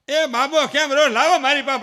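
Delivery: put-on loud, high calling of an old grandmother; hammed-up granny fussing. Grandmother demanding the crying baby, loud call.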